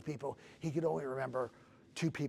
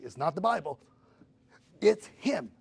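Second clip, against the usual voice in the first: loud retold reveal, punched higher at the end; emphatic insistence; 5 semitones higher, 11 dB louder than usual. Caller insists on who the source is, emphatic insistence. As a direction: imitate someone hammering the point home.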